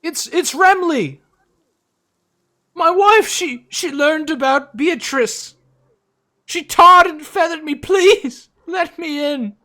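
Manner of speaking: high, loud panicked cry; desperate pleading shout. Acted. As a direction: beg frantically at the door. Desperate man pleading at door.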